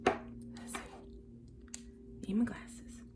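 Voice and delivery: barely voiced murmur while searching; whispering to herself. Real, not acted. Whispering to herself while hunting for her glasses. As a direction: whisper it to yourself, distracted.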